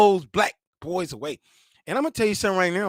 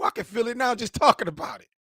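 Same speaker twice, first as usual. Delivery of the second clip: normal talking voice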